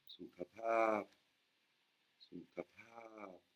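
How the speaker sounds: "sukapap" spelled out very slowly, long and short vowels kept apart; slow careful "sukapap" for learners; slow Thai tone demo. Shown as a demonstration. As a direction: stretch out "sukapap" syllable by syllable. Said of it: "Faites attention aux voyelles courtes".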